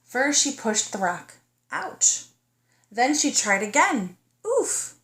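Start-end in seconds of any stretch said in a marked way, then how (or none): 1.7-2.3 mock ouch. Pushing a heavy rock. 4.5-4.9 effort grunt. Acted. Pushing a heavy rock.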